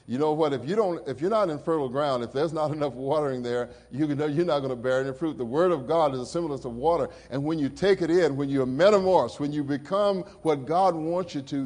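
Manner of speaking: fervent sermon push; rising preaching. Acted. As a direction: drive the point home to the church. Preaching rise.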